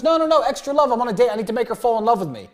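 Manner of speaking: high, loud, fast eager plea; excited insisting. Retold or imitated. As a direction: push it eagerly, voice up. Insisting at drive-thru.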